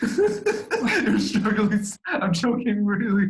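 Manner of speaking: slow, laughing through the words. Real, not acted. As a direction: giggle it out slowly. Laughing at own struggle.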